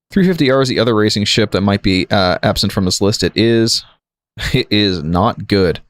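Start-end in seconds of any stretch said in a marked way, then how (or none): none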